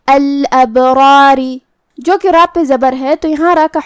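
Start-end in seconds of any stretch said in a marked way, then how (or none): 0.1-1.6 الابراری with the ba bounced and the ra heavy; slow recited الابراری, echoing the ba; qalqala on ba. Shown as a demonstration. Teacher demonstrates الابراری with qalqala on ba. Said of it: "ہمیں با کو ہلاتے ہوئے یہ کلمہ کو ادا کرنا ہے".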